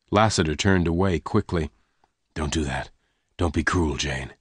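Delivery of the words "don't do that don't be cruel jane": lower, softer, held back; low pained gunman. Acted. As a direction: push her away quietly. Lassiter pulls away.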